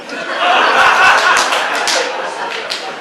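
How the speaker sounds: breathy high cackle at the punchline; helpless wheezing laugh; laughing at own joke. Real, not acted. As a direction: crack up at your own joke.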